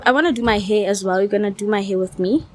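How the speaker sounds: ordinary delivery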